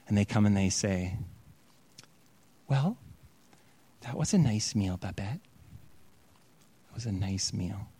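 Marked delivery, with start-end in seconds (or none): 2.7-7.9 hushed thanks. Retold or imitated. Sisters thank Babette awkwardly.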